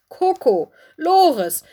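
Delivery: loud, high scolding call of two names; stern. A narrator's reading. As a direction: call the two troublemakers to order. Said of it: "sagte sie streng". (streng zur Ordnung rufend)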